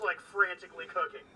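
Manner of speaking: ordinary talk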